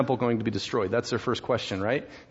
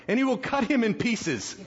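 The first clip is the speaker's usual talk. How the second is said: higher, emphatic verse reading; dramatic scripture punchline; 9 semitones higher than usual. Acted. Preacher reading the servant's punishment, dramatic emphasis. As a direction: lift the pitch for shock.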